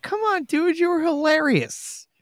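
high, pleading complaint; exasperated. Retold or imitated. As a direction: whine at him in protest. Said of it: "It was like".

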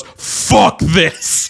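mock-furious storming-off exclamation; imitating angry outburst. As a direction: act out a fan suddenly turning mad. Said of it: "from so happy to so mad".

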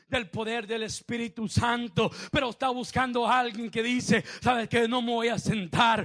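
loud, driving pulpit voice; forceful preaching. Real, not acted. Preacher voicing the willing believer, loud forceful preaching. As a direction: declare it forcefully to the whole church.

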